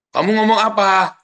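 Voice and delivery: loud, high demand; mock shouted question. Parent questioning the child messenger, mock shouting. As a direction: call it out, pitched well up.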